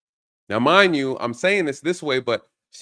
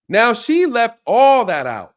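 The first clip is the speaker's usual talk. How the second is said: indignant emphasis; 8 semitones higher, 8 dB louder, slower than usual. Real, not acted. Indignant aside to the audience.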